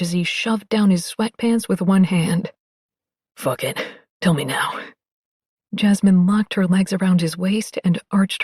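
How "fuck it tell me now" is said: low, soft, urgent; low and impatient, giving in; deep male voice. Acted.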